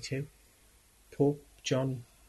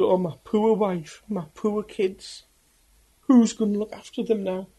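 anguished lament; 7 semitones higher, 6 dB louder than usual. Retold or imitated. Doomed prisoner grieving his wife and kids, high anguished wail.

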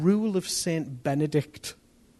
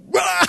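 a sudden high startled yelp; startled yell; 10 dB louder than usual. Retold or imitated. Preacher reliving his fright at the face in the window, a loud startled yelp. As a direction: jump out of your skin and yelp. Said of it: "but I went".

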